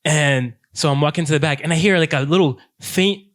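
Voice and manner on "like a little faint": normal talking voice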